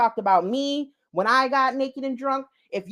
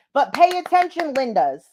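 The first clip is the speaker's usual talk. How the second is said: higher and louder call to listen; 3 semitones higher, 4 dB louder than usual. Real, not acted. Telling her Lindas to pay attention, calling out. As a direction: grab the viewers' attention.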